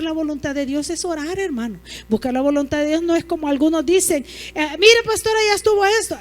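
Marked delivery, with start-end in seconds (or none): 4.5-6.2 higher, louder voice of a satisfied churchgoer; lightly voiced congregant reporting to the pastora; eager church member. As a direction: play the member who thinks the job's done.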